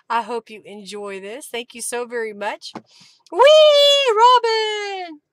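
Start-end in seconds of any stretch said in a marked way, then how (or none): 3.3-5.2 high, loud squeal of delight; gleeful loud whoop; cheering. Real, not acted. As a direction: squeal the name in joy. Cheering the winner.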